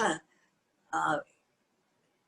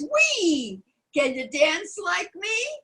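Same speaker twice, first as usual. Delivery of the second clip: high, squealing little frog; high excited frog voice; excited frog cry; 8 semitones higher, slower than usual. A narrator's reading. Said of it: "frog cries".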